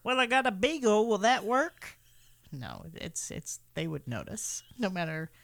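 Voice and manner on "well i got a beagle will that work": imagined seller. Pretend. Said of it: "did they have different ones".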